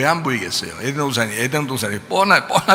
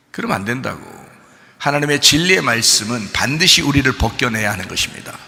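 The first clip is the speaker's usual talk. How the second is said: usual speaking voice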